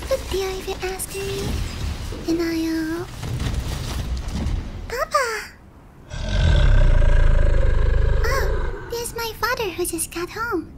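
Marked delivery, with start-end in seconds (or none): 4.9-5.3 high, loud cry of surprise; startled shout to a parent; startled call. Acted. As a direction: call out, caught off guard. Father suddenly arrives home.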